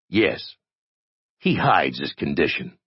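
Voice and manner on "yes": plain reading voice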